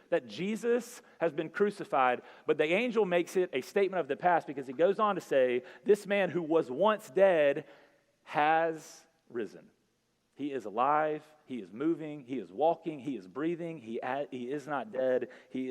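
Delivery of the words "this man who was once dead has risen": slow proclamation. Acted. Preacher quoting angel's proclamation.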